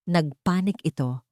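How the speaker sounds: plain reading voice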